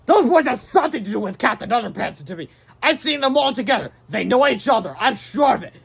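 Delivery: high, loud cartoon villain. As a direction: bark it like a scheming cartoon baddie.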